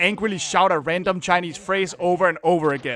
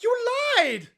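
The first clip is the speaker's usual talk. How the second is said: very high, drawn-out whine; wounded accusation; 13 semitones higher, much slower than usual. Pretend. Player accuses the lamp seller of lying, wounded whine. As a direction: wail like a betrayed child, hammed up.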